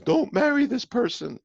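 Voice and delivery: high, loud mock scream of warning; gut screaming. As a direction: shriek it like a panicking inner voice. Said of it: "Your gut is screaming".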